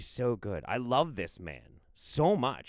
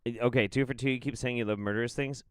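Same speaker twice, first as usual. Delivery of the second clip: normal talking voice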